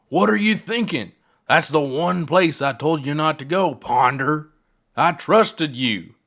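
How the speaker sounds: a father exploding at the pilot who endangered his daughter; furious outburst. Acted. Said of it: "Feldspar erupted".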